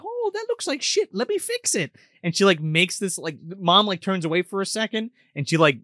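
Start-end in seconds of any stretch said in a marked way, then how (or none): 0.0-2.0 high, soft dainty fairy voice; fairy queen. Retold or imitated. Fairy queen judges mom's painting, high and soft. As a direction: sniff at it like a prissy fairy. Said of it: "the fairy queen's like".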